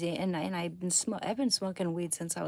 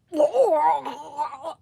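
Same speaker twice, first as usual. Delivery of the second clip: loud crying laugh; 8 semitones higher, 11 dB louder than usual. Real, not acted. Laughing hard.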